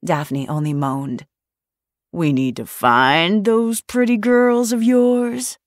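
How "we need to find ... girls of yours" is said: slow, sleepy, loud and higher menace; menacing drawl. A narrator's reading. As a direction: drag it out like a lazy predator. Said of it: "drawled the third voice, slow and sleepy and laced with menace".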